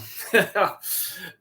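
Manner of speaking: short high laugh; real laugh, falling away. Real, not acted. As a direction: burst into a brief laugh. Amused at his own reading.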